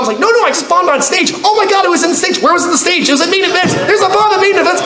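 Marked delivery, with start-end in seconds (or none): 0.2-3.1 mock alarm over a bomb; hammed-up panicked exchange; frantic shouting. 3.1-4.8 high, urgent mock report; half-hammed frantic alert; panicked report. Panicked responder retold.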